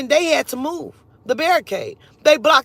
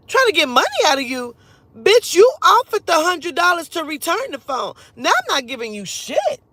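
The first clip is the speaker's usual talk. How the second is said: louder, higher incredulous echo; indignant retort; 6 semitones higher, 5 dB louder than usual. Retold or imitated. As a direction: throw the accusation back, voice raised. Incredulous echo of his accusation.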